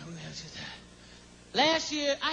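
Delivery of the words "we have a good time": soft murmured repeat, much softer; quiet echo. Real, not acted. Host to crowd, repeating himself in a soft murmur. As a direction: let the echo fall away almost to nothing. Repeats line, second fades.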